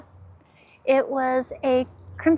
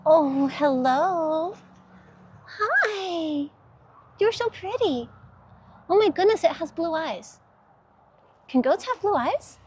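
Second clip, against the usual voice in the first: cooing to animals; 3 semitones higher than usual. Real, not acted. Woman greeting friendly goats, high cooing baby talk. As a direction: coo at them like sweet little pets.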